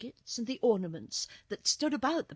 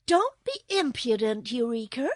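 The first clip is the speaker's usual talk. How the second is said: louder, higher, mild scolding; admonishing; 4 semitones higher, 8 dB louder than usual. A narrator's reading. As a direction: reprove the kitten as a little girl. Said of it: "admonished Dorothy".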